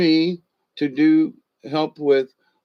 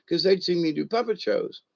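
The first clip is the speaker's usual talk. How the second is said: plain reading voice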